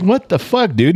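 alarmed. Retold or imitated. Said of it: "I'm like".

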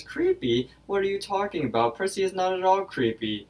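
put-on high, old-fashioned gentleman voice; retelling a cartoon, high voiced Pops protesting. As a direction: squeak out the indignant old man. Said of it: "Pops disagrees and says".

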